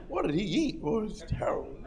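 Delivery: soft grossed-out murmur; hushed at a bad smell; quiet mock disgust; disgusted whisper. Stranger reacting to the smell.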